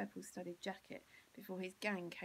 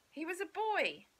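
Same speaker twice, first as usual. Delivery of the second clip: higher, louder burst of joy; joyful; 10 semitones higher, 8 dB louder than usual. A narrator's reading. Narrator rejoices that Bill is a boy again, loud and high. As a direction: let the relief burst out.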